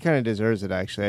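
normal talking voice

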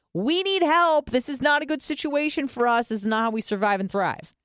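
high-pitched mock plea for help; alarmed ancestral brain. Ancestral brain warning its depressed owner, high-pitched mock alarm. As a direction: voice an urgent inner survival alarm. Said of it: "That's your ancestral brain saying".

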